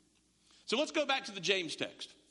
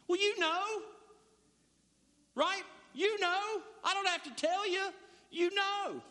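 higher, pleading husband voice; the husband squirming out of it, pitched up; defensive husband; 8 semitones higher than usual. Retold or imitated. Husband's defensive reply, acted.

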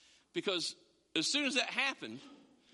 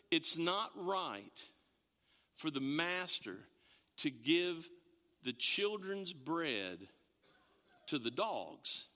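slow solemn Jesus; 5 semitones lower, much slower than usual. Acted. Jesus quoted, slow and low.

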